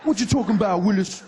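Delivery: sitcom catchphrase. Retold or imitated.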